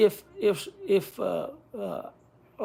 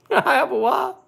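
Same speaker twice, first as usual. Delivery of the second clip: laughing; 5 semitones higher, 4 dB louder than usual. Real, not acted. Laughing retort to friend.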